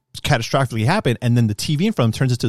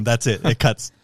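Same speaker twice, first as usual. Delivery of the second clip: ordinary delivery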